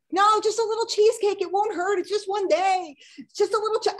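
high, loud pushy mother voice; pushy mom. Retold or imitated. Mom pressing cheesecake on her daughter, high and pushy. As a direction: wheedle like a mother who won't take no.

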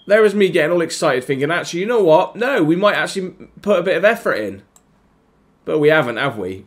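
high, loud, sarcastic; mock his own hopes; bitter rant. Real, not acted. Gamer ranting about his team's lack of effort, bitter. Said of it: "I'm just getting annoyed now".

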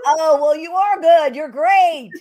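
high, loud, delighted praise; gushing enthusiasm. Real, not acted. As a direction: gush right back at the guest. Host gushes back at guest's compliment.

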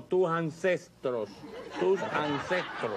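slow, stressed "tus ancestros" with a laugh; correcting the word. Shown as a demonstration. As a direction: spell out "ancestros" slowly as a correction. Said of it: "Tus antecedentes no".

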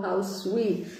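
ordinary delivery, nothing marked

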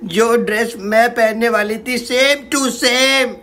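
ordinary talk

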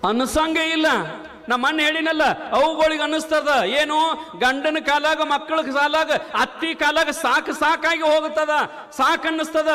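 high, ringing sermon voice; rising pulpit proclamation; fiery preaching. Acted. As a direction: preach it out to the crowd. Preacher raises voice.

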